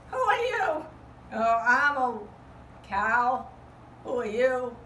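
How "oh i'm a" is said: normal talking voice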